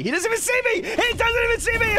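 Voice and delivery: high, excited squealing shout; gleeful disbelief. Real, not acted. Player creeping up on an unaware opponent, excited high shout.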